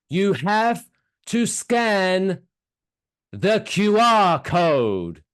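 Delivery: overly loud slow tourist English. Retold or imitated. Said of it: "because he said it louder than me".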